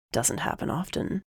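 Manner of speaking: soft, unvoiced teasing aside; breathed playful jab. A narrator's reading. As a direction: tease her under your breath. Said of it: "he teased".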